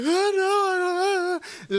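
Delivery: put-on high wailing sob of a child; mock sobbing. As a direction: cry out "no" in fake tears. Kid crying while punished.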